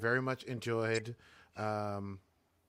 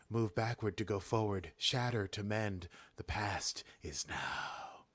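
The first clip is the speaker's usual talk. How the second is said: creepy; 2 semitones lower, 5 dB quieter, slower than usual. Retold or imitated. Queen sending them through time, creepy and slowing. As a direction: recite it like an eerie, trance-like prophecy. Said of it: "It's creepy".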